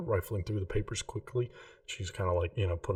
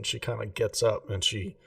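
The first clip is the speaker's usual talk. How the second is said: ordinary talk, nothing marked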